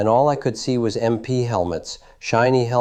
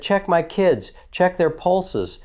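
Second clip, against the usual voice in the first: urgent plea; 9 semitones higher, slower than usual. Retold or imitated. Retelling urgent orders to MP.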